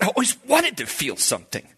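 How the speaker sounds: high-pitched, overcome, acted; choked-up wonder, retold. New convert telling his pastor he wanted feeling, choked up.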